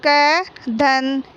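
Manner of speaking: slow, sound-by-sound क धन ई धन ल; teacher slowly joining the letters of कील; slow spelling out. Shown as a demonstration. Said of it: "तो हम".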